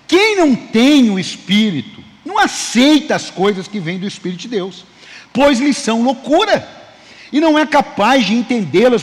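loud, slow preaching read of the Bible verse; scripture reading. Acted. Pastor reading First Corinthians to the congregation, loud and slow. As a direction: proclaim the verse to the church at full force.

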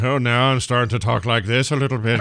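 put-on touch of Irish; mock Irish accent. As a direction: slip lightly into an Irish lilt. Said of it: "Here's the accent coming and going".

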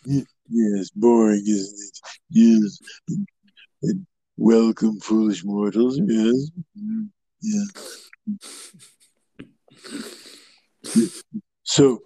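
slow theatrical villain menace; ghost host voice. Acted. Haunted Mansion ghost host impression.

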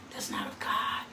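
hushed whisper to the congregation; whispered aside. Real, not acted. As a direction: lean in and whisper it. Pointed aside during sermon.

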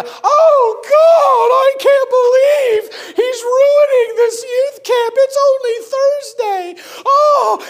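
high, wailing mock-lament of shocked ladies; mocking church ladies. Church ladies wailing over the ruined youth camp, mock lament. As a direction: ham up the horrified church ladies. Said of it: "They were".

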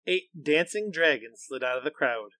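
plain reading voice

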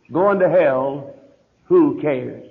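slow it right down and let the title sink in; slow emphatic repeat. Shown as a demonstration. Pastor repeats his sermon title to the congregation, slow and weighty. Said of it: "on the subject".

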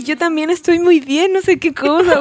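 high, loud, laughing pet voice; dog's voice. Retold or imitated. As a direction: voice the dog sweetly through laughs. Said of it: "como que narran los sentimientos del perro".

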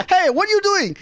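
put-on high-pitched cop with wobbly French accent; mock French accent. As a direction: challenge them in a bad French accent. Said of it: "He was French for some reason".

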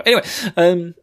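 normal talking voice

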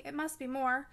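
plain reading voice

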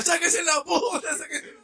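high laughing mock yell; high laughing call to fight; mock egging on. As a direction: egg them on, laughing.

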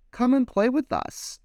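high playful call to play. Acted. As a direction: call the fish over happily. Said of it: "they would call".

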